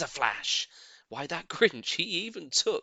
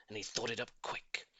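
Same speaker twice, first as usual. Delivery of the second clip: usual speaking voice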